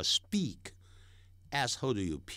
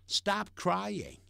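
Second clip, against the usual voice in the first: firm command, Stop raised; stress on stop; 8 semitones higher than usual. Shown as a demonstration. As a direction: Stop hit high and firm. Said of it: "别再哭了".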